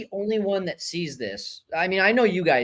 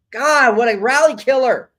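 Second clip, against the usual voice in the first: frustrated; 7 semitones higher, 8 dB louder than usual. Real, not acted. Fan groans at a lost rally.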